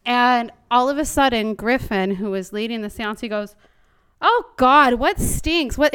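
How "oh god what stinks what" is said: loud, high recoil at a bad smell; disgusted surprise. Seance leader suddenly recoiling from a foul smell. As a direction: react like a stink just hit you. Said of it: "starts going on about something smelling".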